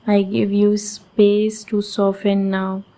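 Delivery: normal talking voice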